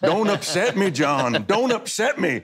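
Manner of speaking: mock obsessed fan pleading. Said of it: "as some hick from some trailer park".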